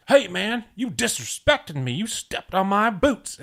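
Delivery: high, slow mock Southern indignation; imitating the cowboy friend. Retelling the friend at the bar, mock offended. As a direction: mimic a touchy guy defending his boots. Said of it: "he was just like".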